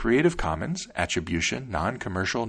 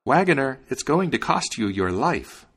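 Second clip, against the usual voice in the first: sparrow's raised, higher call; 6 semitones higher than usual. A narrator's reading. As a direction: call out a warning as the little sparrow. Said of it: "called out".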